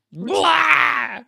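high, loud shouted blah; frustrated yell. Acted. Venting frustration on the podcast, a loud shouted blah. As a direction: let out a loud exasperated blah. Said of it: "Podcasters be animated".

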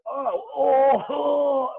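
high, loud choking gasps of pain; choking cry. Retold or imitated. Hairstylist inhaling mace, high loud pained gasps. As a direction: cough out the mace in shock. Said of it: "And I went".

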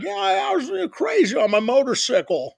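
put-on crackle it out like an old cowboy sidekick; Walter Brennan. Retelling the painter's motorcycle story in his old-timer voice. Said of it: "he talks like Walter Brennan".

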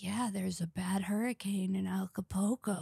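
mock whisper, quiet and breathy; driver's small talk imitated in a whisper. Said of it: "And she's like".

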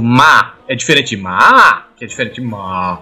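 change only the pitch of each "ma"; contrasting tones on MA. Shown as a demonstration. Explaining Chinese tones to co-hosts, contrasting tones. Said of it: "só mudar a entonação da sílaba".

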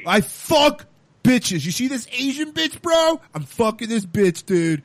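high, hammed-up dude-bro brag; super-straight bro. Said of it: "he's super straight".

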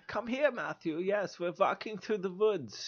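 slow, soft, high German accent. Shown as a demonstration. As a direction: coax him along in a put-on German accent. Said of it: "speak with your best German accent".

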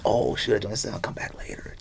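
unvoiced, hushed aside to himself; imitating a quiet inner whisper; mock whisper. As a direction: whisper it like a private thought while browsing.

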